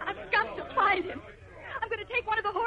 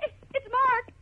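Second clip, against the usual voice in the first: louder, breaking with tears of relief; tearful relief; 5 semitones higher, 8 dB louder than usual. Acted. Girl sees Mark arrive to save the dog, tearful relief. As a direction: blurt it out, overcome with relief.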